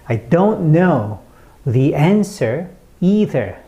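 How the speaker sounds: slow, stressed "don't know", pause, then "answer", "either"; broken, stressed delivery. Shown as a demonstration. Teacher models for learners, slow stress on "don't know", "answer", "either". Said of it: "이런 식으로 발음을 해주셔야".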